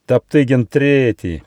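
громкое, протяжное презрение; брось имя свысока, растягивая слова; презрительно. A narrator's reading. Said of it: "презрительно пролаяла Динго". (презрительный возглас дикой собаки)

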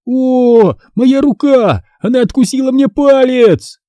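very high-pitched acted cry of pain; high, panicked yelp; pained scream. A narrator's reading. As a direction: cry out in alarm, voice shooting up. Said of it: "Карло закричал".

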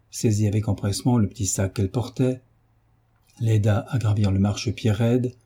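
plain reading voice

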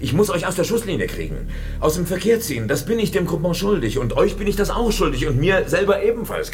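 high-pitched, insistent persuasion; agitated pleading. Acted. Boss urging comrades out of the firing line, agitated. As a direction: press the point with mounting urgency.

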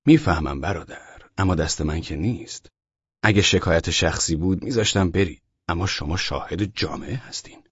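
low, soft sympathetic voice; soft, low, calm regret; kindly officer. A narrator's reading. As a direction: gently explain that your hands are tied. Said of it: "افسر نگهبان که آدم مهربانی بود".

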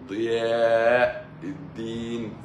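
mock the man who says ضياء with a light ض; imitating softened pronunciation. Imitating the school man calling Karma's name, slow and mocking. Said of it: "فبيقولها إيه؟".

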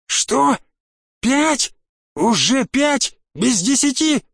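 very high, panicked echo questions; stretched, squealed questions in acted panic; horrified disbelief. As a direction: repeat the time back in rising horror. Horrified to learn the time.

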